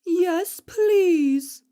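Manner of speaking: plummy lady of the manor, drawn-out please; posh high-pitched lady. Acted. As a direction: answer like a grand dame granting a favour. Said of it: "I answer back in the same high-pitched, posh voice".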